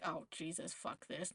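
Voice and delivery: low, soft inner cursing; retold private dismay, kept down; dismayed aside. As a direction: swear under your breath in dread. Dismayed inner reaction to unsure nurse.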